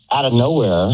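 ordinary talk

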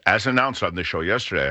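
ordinary talk

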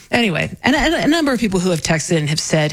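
ordinary delivery

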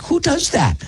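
high, amazed disbelief; who could do such a thing; incredulous. Retold or imitated. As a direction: act stunned at the very idea. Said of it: "and I'm like".

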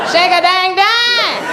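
high, slow called-out line; spoken hook. Pretend. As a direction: call it to the crowd. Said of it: "when the big women get up there".

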